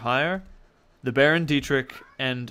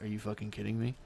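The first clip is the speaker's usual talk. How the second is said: low, soft, stunned dread; quiet alarm, barely voiced; hushed disbelief; 4 semitones lower, 12 dB quieter, faster than usual. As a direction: mutter it in shock. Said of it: "And he's like".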